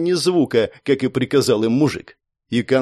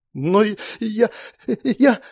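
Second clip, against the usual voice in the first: frightened stammer; 8 semitones higher, slower than usual. A narrator's reading.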